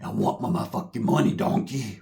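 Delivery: low gravelly ogre growl; angry Shrek. Retold or imitated. Toko demanding money from the Samoan, gravelly growl. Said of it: "starts going into, like, a grovelly voice".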